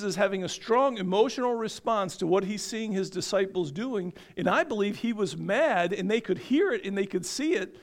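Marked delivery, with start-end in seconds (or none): none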